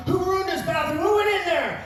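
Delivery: put-on high, angry demand at the boys; in a rage. Coach to his wrestlers after the complaint: high angry demand. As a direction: round on the kids, furious. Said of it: "I'm in a rage now".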